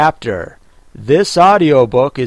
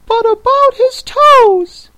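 very high, louder creature voice; persistent Mock Turtle; 8 dB louder than usual. A narrator's reading. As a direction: insist in a high, fluting turtle voice. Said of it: "the Mock Turtle persisted".

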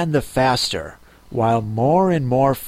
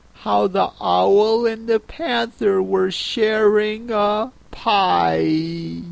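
higher, slow and softer, hesitant recital; trembling voice; 7 semitones higher, 3 dB quieter, slower than usual. A narrator's reading. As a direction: recite timidly, afraid it's coming out wrong. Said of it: "went on in a trembling voice".

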